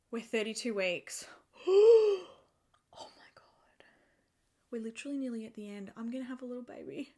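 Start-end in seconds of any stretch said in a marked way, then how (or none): 1.6-3.8 gasped, whispered oh my God, much higher; breathless realisation, drawn out slowly; gasp of awe. Real, not acted. Realising the baby is nearly due. 4.7-7.1 softer and quicker, half to herself; quietly taking it in; quiet fast aside before the emotion hits; hushed wonder. Real, not acted. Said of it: "I'm so emotional".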